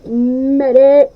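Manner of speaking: calling loudly for a medic; man down shout. Real, not acted. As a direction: shout it out across the field. Hit in game, calling medic.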